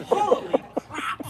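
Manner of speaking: very high, loud, slow holy crap with a laugh; hammed-up exasperated wail; laughing mock exasperation. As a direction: ham up her climbing complaint while laughing. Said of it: "she'd say".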